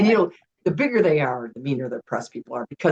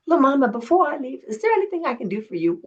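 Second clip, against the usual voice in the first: lightly voiced Snoop, pitched up; [name] impression; 10 semitones higher than usual. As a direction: offer a favor, easy and cool. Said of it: "And he goes".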